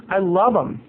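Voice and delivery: normal talking voice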